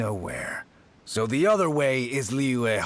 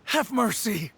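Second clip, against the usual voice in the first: nervous pleading; 7 semitones higher than usual. Acted. Treasure hoarder begs the Adeptus.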